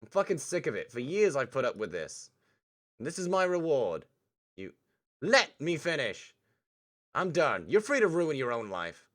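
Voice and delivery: loud, high, bitter venting; angry rant. Acted. Damien vents his years of resentment at Olivia, loud angry rant. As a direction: unload years of resentment at full voice.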